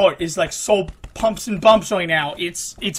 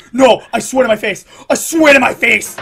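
loud, emphatic outburst; frustrated protest; 3 semitones higher, 9 dB louder than usual. Real, not acted. Player swearing at the game, loud and frustrated. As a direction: insist loudly, punching the words.

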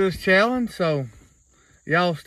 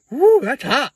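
high loud whoop from the heat; burning mouth; 9 semitones higher, 4 dB louder, slower than usual. Real, not acted. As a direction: gasp it out as the spice hits. Burning mouth from hot jelly bean.

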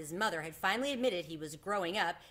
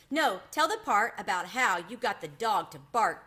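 eager plea, louder; pleading; 3 semitones higher, 7 dB louder than usual. A narrator's reading. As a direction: beg loudly for a favourite part. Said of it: "pleaded a third".

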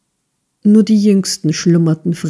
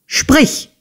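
high, abrupt order; impatient, menacing imperative; sharp command; 8 semitones higher than usual. A narrator's reading. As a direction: demand an answer at once. Schoolmaster orders terrified boy to answer.

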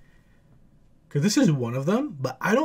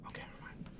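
whisper; 28 dB quieter, faster than usual. Real, not acted. After holding a sneeze.

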